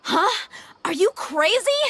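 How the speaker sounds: high alarmed disbelief; shocked outburst. Acted. Hero seeing the captive bite his tongue, shocked. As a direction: blurt it out in shock. Enemy bites own tongue.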